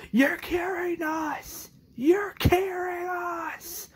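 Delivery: slow, very high strained mock yell; retold yelling. Rick yells at the closed gate, high drawn-out mock scream. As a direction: imitate a drawn-out desperate scream without full volume. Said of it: "Rick yells and says".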